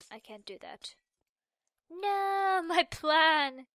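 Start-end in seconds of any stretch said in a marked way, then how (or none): 1.9-3.7 loud, higher wail of dismay; dismayed cry. Real, not acted. Gamer wailing as her jump plan fails, a loud dismayed cry.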